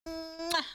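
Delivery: soft blown kiss; goodnight kiss. Real, not acted.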